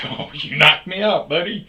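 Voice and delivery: mock-defeated loud taunt; mock shout. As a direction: sarcastically yell at him. Narrator mocks being knocked out.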